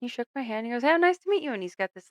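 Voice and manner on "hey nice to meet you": high, loud, fake-polite greeting; mock cheerful nice to meet you; forced friendliness. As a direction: put on a strained, forced-smile hello. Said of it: "forced smile on his face".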